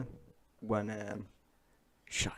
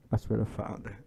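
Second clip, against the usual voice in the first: ordinary talk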